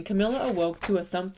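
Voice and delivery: plain reading voice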